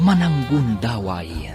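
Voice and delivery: normal talking voice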